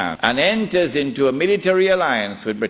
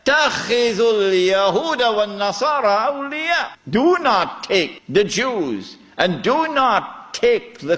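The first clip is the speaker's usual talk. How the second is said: forceful scripture recitation; 8 semitones higher than usual. Acted. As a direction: proclaim the scripture as a solemn command, pitched high.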